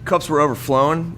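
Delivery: ordinary talk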